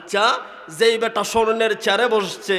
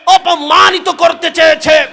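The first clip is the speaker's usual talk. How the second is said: preaching at full force; 5 semitones higher, 9 dB louder than usual. Acted. Preaching climax, shouted.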